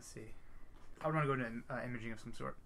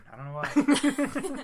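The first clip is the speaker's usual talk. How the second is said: mock despair; 14 dB louder, much slower than usual. Real, not acted. Joking about a bowling record.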